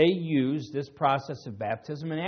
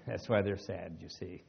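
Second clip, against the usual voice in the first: retelling the joke with a laugh; chuckling through the pun; 6 semitones lower, 8 dB quieter than usual. Shown as a demonstration. Preacher repeats the Sadducee pun, laughing. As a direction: let a chuckle into the punchline. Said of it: "that's a Dave Hunt joke".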